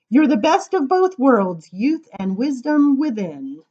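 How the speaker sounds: bright, lilting read-aloud voice; reading to children. Acted. Reading a funny giraffe poem to children, sing-song. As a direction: read the rhyme playfully to the kids.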